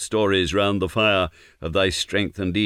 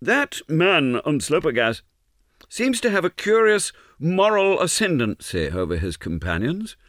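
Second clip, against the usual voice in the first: high-pitched musing voice; thoughtful remark; 8 semitones higher than usual. A narrator's reading. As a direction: muse aloud in a much higher voice. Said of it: "remarked thoughtfully".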